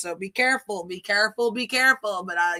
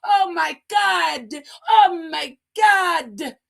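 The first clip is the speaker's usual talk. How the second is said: put-on slow, stretched, loud and higher like an overjoyed child; mock thrilled squeal; 6 semitones higher, 5 dB louder, much slower than usual. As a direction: drag it out with big excitement. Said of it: "They should be saying".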